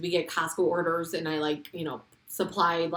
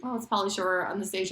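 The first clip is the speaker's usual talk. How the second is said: casual, underwhelmed remark; unimpressed crowd; 4 dB quieter than usual. Retold or imitated. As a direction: imitate a room that barely cares. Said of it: "everyone was just like".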